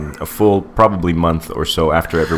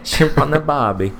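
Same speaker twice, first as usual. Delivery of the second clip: high cartoon Aussie catchphrase; mock Australian; 9 semitones higher than usual. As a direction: toss off the cliché in a hammed-up Aussie twang, much higher. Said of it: "Australian stereotype".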